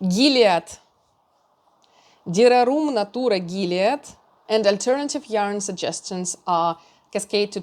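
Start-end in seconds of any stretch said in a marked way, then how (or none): 0.1-0.6 Gilead in a playful Russian accent. Shown as a demonstration. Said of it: "I will read it with a Russian accent". 2.3-4.0 Dererum Natura Gilead in a louder Russian accent. Shown as a demonstration. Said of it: "I will read it with a Russian accent".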